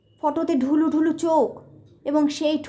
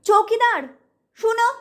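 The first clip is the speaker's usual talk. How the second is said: higher, louder call to the caretaker; 7 semitones higher, 3 dB louder than usual. Acted. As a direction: call after him down the long verandah. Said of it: "আমি তাকে পিছন থেকে ডাকলাম".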